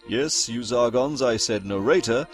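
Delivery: usual speaking voice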